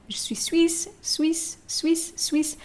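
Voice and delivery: suisse with a hissing s; repeated demo; double s demo. Shown as a demonstration. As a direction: stress the S sound in suisse. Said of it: "double S".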